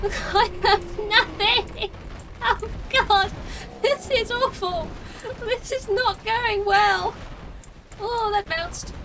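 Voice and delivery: slow, drawn-out loud wail, pitched high; panicked wail. Pretend. Player with no ammo losing the fight, panicked wail. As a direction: wail every word in comic despair.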